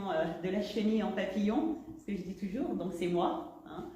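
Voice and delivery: ordinary delivery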